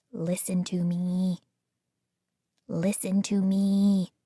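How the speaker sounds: hushed, even robot drone; metallic robot voice. A narrator's reading. Robot Francine repeating from the corner, metallic voice. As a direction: speak like a flat machine, barely voiced. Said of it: "in her metallic robot voice".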